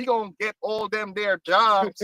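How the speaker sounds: mock American accent. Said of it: "I gotta do my American accent now".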